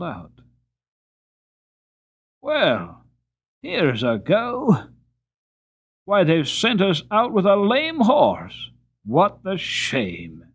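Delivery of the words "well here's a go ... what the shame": loud, slow exasperated driver; hammed-up cabman grumbling loudly; careless driver. A narrator's reading. Driver grumbling loudly about the lame horse.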